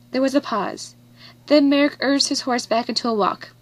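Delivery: plain reading voice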